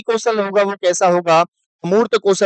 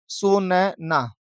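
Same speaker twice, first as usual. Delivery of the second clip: drawn out; 3 dB quieter, much slower than usual. Shown as a demonstration. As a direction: stretch सुनना out very slowly, syllable by syllable. Teacher drawing out the answer.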